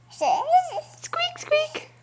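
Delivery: tiny, very high squeaky voice; mouse squeak. Pretend. Parent voices a little mouse, squeaking. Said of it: "A little mouse?".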